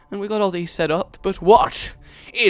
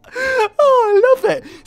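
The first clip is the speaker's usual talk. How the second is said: high squeal, louder; delighted squeal; 6 dB louder, much slower than usual. Real, not acted. Gamer loves the spread, delighted squeal.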